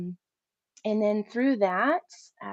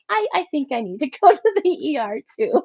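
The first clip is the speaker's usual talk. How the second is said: laughing through the words, higher and louder; 7 semitones higher, 8 dB louder than usual. Retold or imitated. To her friend at home, sick but still laughing. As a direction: giggle out the admission despite the danger.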